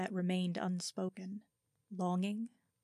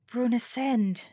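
glad greeting; 4 semitones higher, 7 dB louder than usual. Acted. The Vicomtesse greets her gladly.

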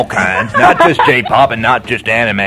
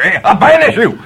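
loud, higher mock exorcism; mock banishing; 7 semitones higher, 4 dB louder than usual. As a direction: cast him out with hammy fury. Banishing the intruder.

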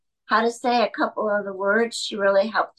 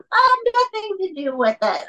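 high-pitched, disbelieving exclamation; retold bewildered disclaimer, pitched way up; incredulous protest; 9 semitones higher than usual. Exclaimed protest at undeserved congratulations.

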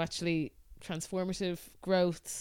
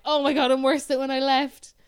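retold dismay; 3 semitones higher, 7 dB louder than usual. Retelling her dismayed reaction on return.

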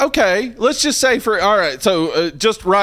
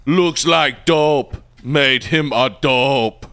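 slow mock Arnold one-liner; deliberate, drawn-out Schwarzenegger impression; Schwarzenegger quip; slower than usual. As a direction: drag out a cheesy action-hero kill line. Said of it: "he's gotta make a crack about".

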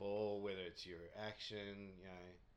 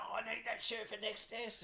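much higher mock-pleading voice; pleading cricketer; 13 semitones higher than usual. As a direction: squeak it like a put-out player begging for his shirt. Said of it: "He's going".

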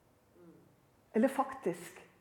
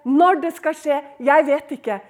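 ordinary talk

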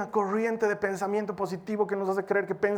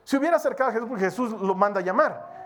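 normal talking voice

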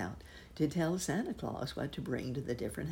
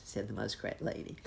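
ordinary delivery, nothing marked

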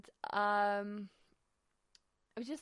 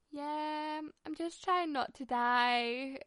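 mock strained; 6 semitones higher, 4 dB louder, much slower than usual. Answering Kevin while struggling.

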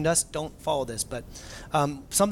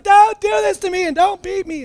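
put-on high-pitched shrieking toddler plea; squealing child begging; mock toddler scream; 14 semitones higher, 7 dB louder than usual. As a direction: shriek it like a terrified little kid. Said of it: "she's screaming".

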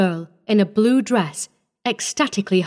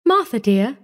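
ordinary delivery, nothing marked

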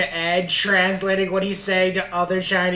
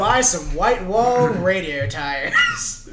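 slow, hammed-up ad pitchman; radio ad voice; 2 semitones higher than usual. Co-host joining in with fake tire ad, radio pitchman. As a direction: stretch it out like a cheesy radio ad. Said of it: "this beautifully trained radio voice".

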